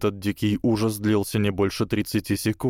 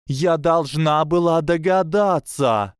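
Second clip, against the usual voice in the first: much higher and louder, slow; thrilled; 8 semitones higher, 5 dB louder, slower than usual. A narrator's reading. Lavender jumping with delight, high thrilled cry. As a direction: bounce with glee.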